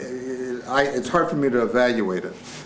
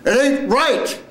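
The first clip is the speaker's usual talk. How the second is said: very high, slow cry; full-out acted line; 12 semitones higher, 3 dB louder, slower than usual. Actor ending the first scene, high drawn-out cry. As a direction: throw it up high and hold it.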